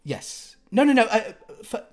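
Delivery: fussy rich lady. Acted. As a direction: fuss over the antique couch. GM voices Odette directing movers.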